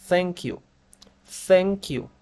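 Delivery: "Thank you" as a careful teacher's model; model pronunciation. Shown as a demonstration. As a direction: say "Thank you" clearly, th like a Castilian Z. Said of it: "escúchame la pronunciación".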